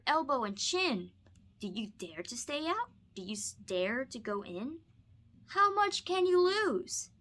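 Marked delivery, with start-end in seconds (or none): none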